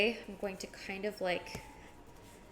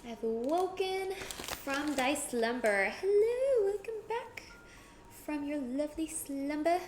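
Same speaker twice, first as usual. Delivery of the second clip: mock dramatic; 6 semitones higher than usual. Mock grand greeting to chat.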